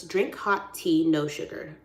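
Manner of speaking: "Drink Hot Tea" slow and evenly spaced; slow, careful "Drink Hot Tea, no sugar" to memorise it; really slowly. Real, not acted. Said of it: "say your name, like, really slowly".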